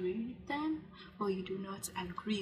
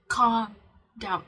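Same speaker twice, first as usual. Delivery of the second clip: calm down said much louder, firm and declared; loud and clear; 2 semitones higher, 9 dB louder than usual. Shown as a demonstration. Telling viewers to profess it, loud and firm. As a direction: proclaim it out loud to yourself. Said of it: "Profess it out loud".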